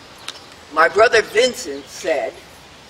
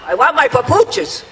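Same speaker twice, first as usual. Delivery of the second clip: high, sudden shout to halt; acted-out yell; 10 semitones higher, 3 dB louder than usual. Father on the stretcher yells to the attendant, acted-out shout. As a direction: call out a loud, high demand. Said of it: "my father yelled out to the attendant".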